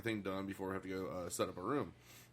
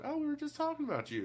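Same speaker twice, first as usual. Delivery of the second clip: high, lightly voiced woman on the line; lady on the phone. Receptionist greeting the engineer, high chirpy voice.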